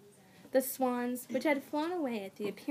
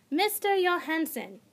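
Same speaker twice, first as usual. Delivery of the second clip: stern teacher calling out; 6 semitones higher, 6 dB louder than usual. A narrator's reading. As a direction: call sharply across the room. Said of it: "called from the front".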